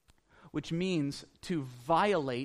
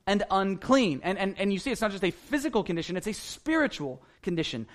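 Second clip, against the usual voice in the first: ordinary delivery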